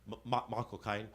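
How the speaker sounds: low, Cockney Michael Caine. Acted. Comic answers the phone as Michael Caine, low Cockney. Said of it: "and I do this".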